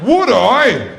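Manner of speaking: high, loud eager reply through a laugh; eager yes. Retold or imitated. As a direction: jump at the offer, thrilled.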